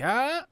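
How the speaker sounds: high-pitched, loud "ah"; mock yell. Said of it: "kinda yelling".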